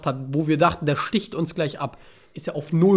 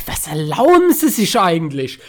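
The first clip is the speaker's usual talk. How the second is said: mock indignant; 8 dB louder than usual. Indignant retort to the man.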